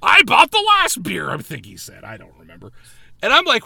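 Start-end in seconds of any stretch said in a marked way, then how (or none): 0.0-1.3 loud, high mock shouting; hammed-up indignant friend, voice raised; irate refusal. Friend refusing to fetch her a beer, hammed-up shouting.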